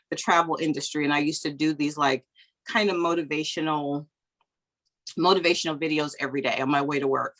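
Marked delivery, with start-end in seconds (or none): none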